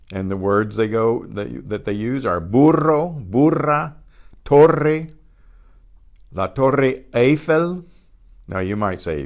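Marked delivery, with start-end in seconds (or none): 2.4-7.9 burro, burra, torre with rolled R; Spanish burro, burra, torre, trilled, slowing into Eiffel as "ay-fel"; trilled double R. Shown as a demonstration. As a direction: roll the R in burro, burra and torre, teacher demonstrating. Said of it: "The double R is a trilled R".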